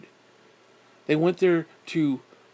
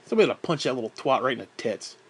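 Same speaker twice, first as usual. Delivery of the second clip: usual speaking voice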